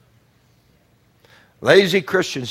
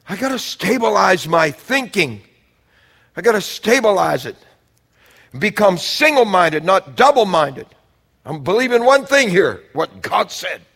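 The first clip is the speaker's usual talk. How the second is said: raised pitch, punched key words; emphatic preaching; 7 semitones higher, 6 dB louder than usual. Real, not acted. Preacher resolving to become single-minded, high emphatic declaration.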